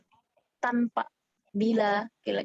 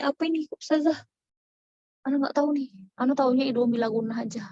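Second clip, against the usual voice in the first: lightly voiced student, pitched up at first; imitated puzzled student; 4 semitones higher, faster than usual. Teacher imitating students meeting an unfamiliar term, lightly mimicked puzzled student. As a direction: mimic a student questioning the teacher, higher then easing down. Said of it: "kalau kalian dapat istilah ini".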